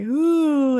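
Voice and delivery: loud, high ghostly ooh; a hammed-up ghost moan; spooky ghost ooh.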